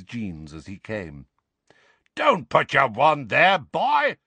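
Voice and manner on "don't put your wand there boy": louder, higher bark of a warning; roaring. Acted. Moody scolding Harry about his wand, roaring. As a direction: roar it at the boy. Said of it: "roared Moody".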